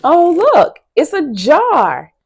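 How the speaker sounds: teacher's mock-amazed discovery; excited reveal. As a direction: show the jar like a delightful surprise. Teacher revealing object to kids.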